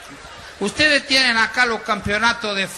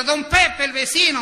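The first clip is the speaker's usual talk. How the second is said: wife answering; 6 semitones higher than usual. Retold or imitated. Wife's reply to the husband.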